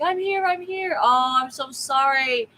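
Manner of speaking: high, excited mock call; mock shout. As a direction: call out like someone late and apologetic. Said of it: "He was shouting".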